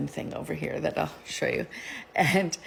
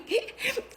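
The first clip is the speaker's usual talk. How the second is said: breathless high-pitched giggle; high unvoiced giggle. Real, not acted. Laughs at own story.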